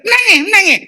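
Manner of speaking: high mock granny scolding; grandma's voice. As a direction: imitate a granny hushing a crying child. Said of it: "Opah kata". (tiruan suara opah yang tinggi)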